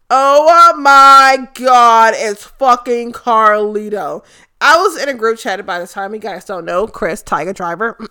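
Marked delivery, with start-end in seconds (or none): 0.1-4.2 loud, stretched-out gasp of joy; excited disbelief. Retold or imitated. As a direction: drag out the oh my God in delight. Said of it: "And I was like".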